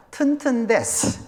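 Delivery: put-on slow Korean with a light Japanese accent. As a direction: say it the way a Japanese speaker would. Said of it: "이거 말해요".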